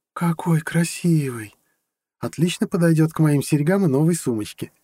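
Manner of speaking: high woman's voice, savoring the first words; delighted admiration. A narrator's reading. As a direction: gush over a new present. Girlfriend admires her gift. (восторженно, высоким девичьим голосом)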